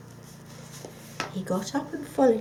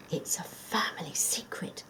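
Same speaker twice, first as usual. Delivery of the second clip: hushed confiding whisper; secretive whisper; 6 dB quieter than usual. Acted. The fox whispering a family secret to the dog. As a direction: let him in on a secret.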